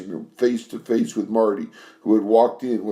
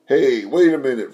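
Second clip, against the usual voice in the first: higher, louder call to stop her; louder, higher shout of hey wait a minute; 5 semitones higher, 6 dB louder than usual. Acted. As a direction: yell after her before the door shuts. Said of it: "Frankie shouted".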